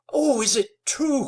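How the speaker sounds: high, loud anguished cry; a young wife crying out in grief. A narrator's reading. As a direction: break into a sob of disbelief. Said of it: "cried Nell".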